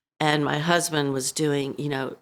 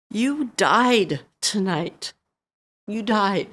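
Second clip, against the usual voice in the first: slow, higher, strained and choked; numb anger; 5 semitones higher, slower than usual. Retold or imitated. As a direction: deliver it stunned and heavy. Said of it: "I was so angry".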